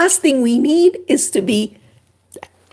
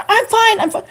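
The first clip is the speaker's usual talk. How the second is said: defensive denial; 9 semitones higher than usual. Retold or imitated. Acted brushing off a pushy friend.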